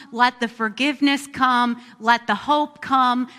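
ordinary delivery

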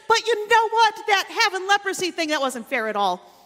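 loud imagined grumble, starting high and dropping; mock complaint. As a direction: voice a hypothetical ungrateful gripe. Imagined grumble after praise.

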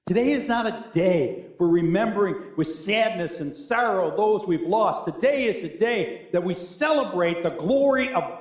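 fervent pulpit conviction; emphatic preaching. Real, not acted. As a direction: preach it as a rising proclamation. Preaches with force.

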